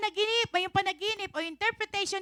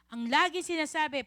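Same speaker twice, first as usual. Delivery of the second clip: ordinary talk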